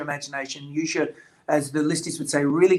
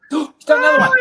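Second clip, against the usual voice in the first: gasp, then a much higher, louder, fast burst of delight; real thrill at the new picture, pitched way up; 11 dB louder, faster than usual. Real, not acted. Author sees the illustrator's new drawing, gasping with delight.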